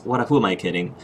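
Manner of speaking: ordinary talk, nothing marked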